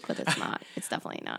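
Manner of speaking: unvoiced whispered denial. Real, not acted. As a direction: whisper the rebuttal. Quiet contradiction aside.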